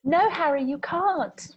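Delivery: high, loud British outburst; British accent. Pretend. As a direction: exclaim it in mock British. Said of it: "British accents are hard".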